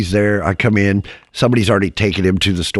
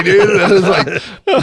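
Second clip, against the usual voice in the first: normal talking voice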